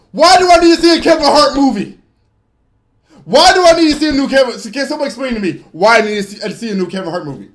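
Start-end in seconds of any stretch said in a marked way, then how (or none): 0.1-4.6 loud, high and rapid protest; exasperated outburst. Acted. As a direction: fire back in exasperated disbelief. Exasperated answer to movie invitation.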